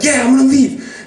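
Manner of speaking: high-pitched impatient answer; imitating a worked-up brother agreeing; agitated assent. Brother promising Nick he will leave, agitated.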